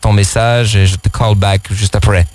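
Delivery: low, slow seducer voicemail voice; smooth ladies' man on the answering machine; leading man. Shown as a demonstration. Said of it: "Et mettre, euh". (voix grave et lente de tombeur)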